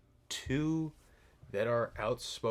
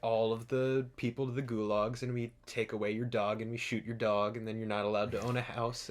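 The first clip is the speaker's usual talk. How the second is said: mock gulag manifesto; 7 dB louder than usual. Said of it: "it's not a book on, like".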